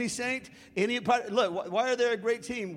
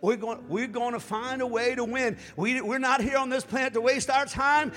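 normal talking voice

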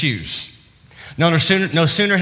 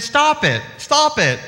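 mock scold; 9 semitones higher, slower than usual. Mother scolding kids in car.

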